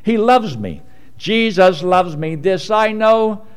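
slow congregational recital; recited slowly. Shown as a demonstration. Preacher leading the congregation in a slow chant. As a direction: lead them through it, slowing at the end. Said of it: "Can you say that?".

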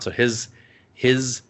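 ordinary delivery

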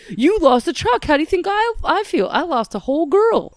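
high loud laughing imitation; loud mock indignant Wang; imitating Wang. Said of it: "Wang says".

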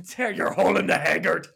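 mock Irish. Mock repeating Irish slang.

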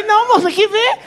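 high, climbing protest; mock alarmed refusal. Patient stopping nurse who lifted the sheet, scandalized.

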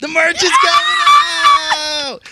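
high, slow, loud shout of the news; hyped yell of the merch news; excited shout. Real, not acted. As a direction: belt out the big reveal.

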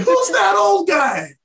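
high, squealed mock outcry; mock disbelief. Jokingly voicing an imagined customer.

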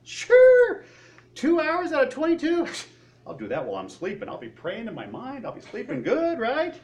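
high, loud and cocky, brushing it off with a laugh; mock proud answer. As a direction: play the overconfident believer who thinks it's easy. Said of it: "in our pride, our initial first answer is what?".

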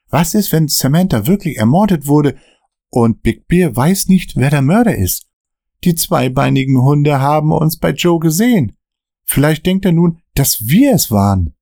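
nervy friend glancing at the mirror; nervous Dan. Acted. Dan fears Big Bear.

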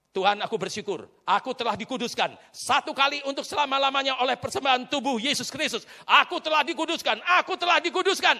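ringing confession to a congregation; preached declaration. Real, not acted.

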